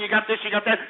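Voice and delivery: ordinary delivery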